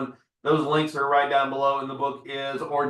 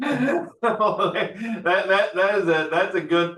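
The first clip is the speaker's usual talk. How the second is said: high, laughing through the words; amused, breaking into laughter; 6 semitones higher, 4 dB louder, much slower than usual. Real, not acted. Host laughing at guest's quip.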